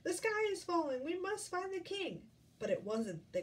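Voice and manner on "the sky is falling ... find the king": high-pitched mock shout; storybook shout, more pitch than volume. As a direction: call out the alarm.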